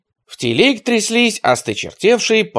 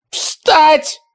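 much higher, loud barked command; sudden shout; 4 dB louder than usual. A narrator's reading. The poet ordering the lords to stand. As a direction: snap it out sharply. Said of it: "внезапно ... воскликнул поэт".

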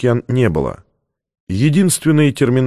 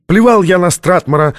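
loud, high angry shout; 7 semitones higher, 4 dB louder than usual. A narrator's reading. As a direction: yell it in defiance. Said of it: "закричал Чатрукиан".

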